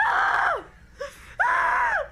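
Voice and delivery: sudden high shriek of fright; startled scream. Real, not acted. Sudden fright while reading.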